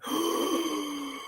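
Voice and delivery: high, soft shocked gasp; mock shocked gasp. [name] mocked being told off, soft shocked gasp. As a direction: gasp like the rug was pulled. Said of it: "And he went".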